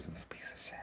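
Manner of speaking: sly whispered jab; whispered aside. Real, not acted. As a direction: whisper it under your breath. Snide aside about Windows.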